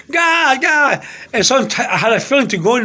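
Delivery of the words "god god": higher, aggrieved call to God; mildly wailed appeal; retold moody cry. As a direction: re-enact calling up to God. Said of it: "I was in a kind of mood".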